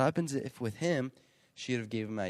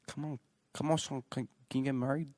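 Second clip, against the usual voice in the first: weak, higher attempt at a Chinese accent; 4 semitones higher, 5 dB quieter, faster than usual. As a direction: try a Chinese accent, half-heartedly. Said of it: "I was trying to do a Chinese accent".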